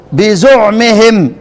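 "بزعمهم" with a u after the z, slowly: bizu'mihim; ضم الزاي. Shown as a demonstration. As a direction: say "بزعمهم" slowly with damma on the zay. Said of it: "انفرد الكسائي بضم الزاي". ("بزعمهم" بضم الزاي، ببطء)

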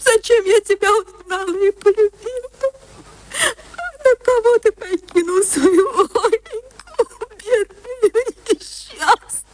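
high, weeping lament over a lost husband; keen and sob for the dead husband; wailing widow's lament, half whispered, half sobbed; tearful lament. Acted.

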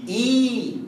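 "إي" as long ee after hamza; yaa of madd. Shown as a demonstration. Teacher answering about yaa, "إي" held long. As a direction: hold "إي" as a pure ee. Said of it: "عايز أعرف مخرج الياء".

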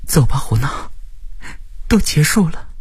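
tender reassurance, airy and high; gentle consoling. Acted. Friend consoles survivor, helping him up.

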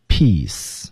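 careful drawn-out "peace" with a clean final s; 发音示范. Shown as a demonstration. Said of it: "舌尖抵下齿背". (舌尖抵下齿背，清晰地读“peace”)

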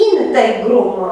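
integrum with the stress on IN, falling after it; stress on antepenult. Shown as a demonstration. Teacher showing the correct Latin stress, first syllable marked. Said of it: "accentuarea este pe antepenultima".

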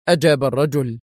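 ordinary delivery, nothing marked

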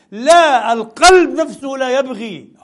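القلب نفسه hammered loud and slow; stress on القلب. Real, not acted. Preacher insisting to the congregation on القلب نفسه, loud and slow. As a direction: pound out القلب نفسه, slowly. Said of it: "preacher stresses heart itself".

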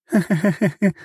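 high tipsy giggle; drunk giggle. Acted. Goddess laughs before threat.

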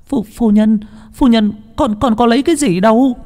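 high, stammering maid protesting her innocence; pleading servant voice, very high; frantic denial. Acted. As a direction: stammer out a panicked denial, pitched far up. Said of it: "miệng chối bai bải".

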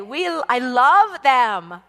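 loud, drawn-out mock gushing; gushing affection. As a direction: stretch it slowly and loudly, imitating effusive love. Mimics people professing love for someone.

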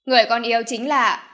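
loud start, suddenly broken off; stopping abruptly. A narrator's reading. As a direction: blurt it out, then catch yourself. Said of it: "Uông Hạo Thiên đột nhiên dừng lại". (đột nhiên dừng lại giữa câu)

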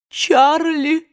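aunt sobbing her late nephew's name, mildly acted; sweet sob. A narrator's reading. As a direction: wail it with some pleasure in the grief. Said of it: "сладко всхлипнула тётушка Питтипэт".

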